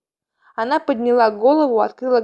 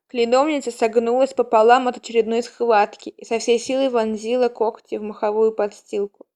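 ordinary delivery, nothing marked

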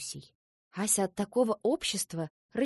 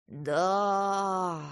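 put-on long pensive yes of an old gnome; drawn out. A narrator's reading. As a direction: stretch the single word right out. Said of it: "протянул старый гном".

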